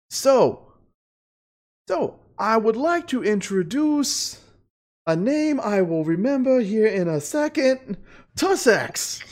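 high slow showman introduction; high squeaky host voice. Acted. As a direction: announce the guest in a squeaky voice.

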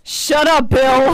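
loud playful yell; shouting. Real, not acted. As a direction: yell it in mock outrage. Table yells at cheesy speech.

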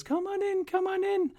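very high, startled mother's voice; surprised old woman. Acted. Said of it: "she says".

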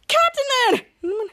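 high, drawn-out acted cry of shock; stretched, high mock-horrified call. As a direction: imitate a hero crying out for a lost friend. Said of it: "were in shock".

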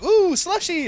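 high, loud ooh slushie; delighted squeal. Retold or imitated. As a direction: squeal like a thrilled kid. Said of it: "and I was like".

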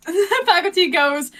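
usual speaking voice

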